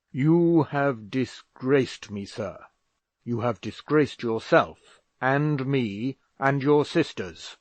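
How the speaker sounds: slow, loud, heavy rebuke; furious father scolding his son; angry father. A narrator's reading. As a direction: hammer home his disgrace.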